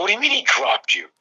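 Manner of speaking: high-pitched, baffled question; retold incredulity. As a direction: act out disbelief at what you've just heard. Retold disbelief at student's news.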